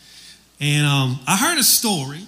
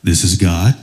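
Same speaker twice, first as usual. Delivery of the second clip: deep, low booming announcement; voice of God; 11 semitones lower, 6 dB louder than usual. Acted. God names himself to the jogger, deep voice. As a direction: boom it out low, like the Almighty. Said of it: "the voice comes back and says".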